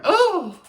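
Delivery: sudden high yelp as he fakes a fall; a hammed-up cry of surprise; mock yelp. Fake fall in store.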